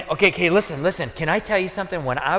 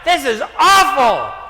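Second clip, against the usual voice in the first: high, loud exasperated yell; frustrated outburst; 11 semitones higher, 6 dB louder than usual. Retold or imitated. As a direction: re-enact fed-up yelling from the sidelines. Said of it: "I would be so frustrated".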